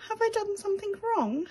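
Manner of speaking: high, anxious little mouse voice; worried he's done wrong, small and unsure; little mouse asking nervously; timid little mouse. Acted.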